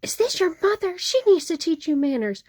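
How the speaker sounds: mock screaming Karen. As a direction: ham up an irate customer scolding. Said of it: "she starts screaming".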